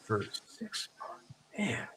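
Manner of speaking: hushed shocked whisper. Real, not acted. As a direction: breathe it out in disbelief. Repeating the price in shock.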